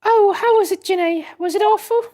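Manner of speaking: put-on high, polite young woman voice; young lady voice. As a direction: speak as a prim, proper young assistant. Voices Susato character.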